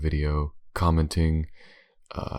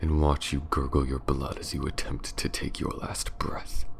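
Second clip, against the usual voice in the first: menacing vampire lady. Acted. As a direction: purr each threat like a cruel castle mistress savouring the hunt. Villainess threatens her prey, whispering.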